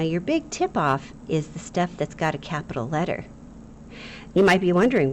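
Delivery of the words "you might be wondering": ordinary talk, nothing marked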